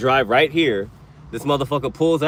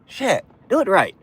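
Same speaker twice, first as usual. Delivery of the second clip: exasperated repeat; 12 semitones higher than usual. Real, not acted. Repeats demand, rising.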